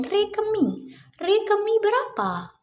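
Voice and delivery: high, lilting teacher's question; singsong quizzing. Pretend. Teacher asks children the interval from re to mi, singsong quizzing. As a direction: pitch it up high to prompt the children.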